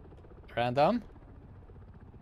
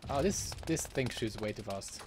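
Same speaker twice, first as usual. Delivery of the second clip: ordinary talk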